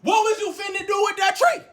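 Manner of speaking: high-pitched, rising demand in a retold argument; acted-out heated challenge; indignant confrontation. Acted confrontation over a threatening joke.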